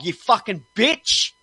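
furious imagined outburst. Retold or imitated. Ranting at a chat agent he wants to fly over to, furious. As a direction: let the fury boil over, pitch up. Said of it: "I felt like saying".

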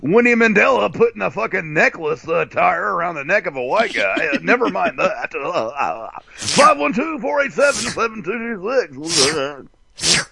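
high, excited sky report; mock radio chopper reporter; mock helicopter traffic report.

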